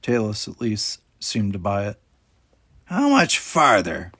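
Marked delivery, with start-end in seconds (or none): 2.8-4.1 slow, louder impatient question; weary impatience; impatient captain. A narrator's reading. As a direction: drag the question out in annoyance. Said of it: "she asked".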